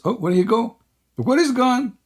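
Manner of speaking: high-pitched, incredulous questions; amused disbelief. Real, not acted. Host finds the opponent has left, amused disbelief. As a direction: voice leaping up in surprise.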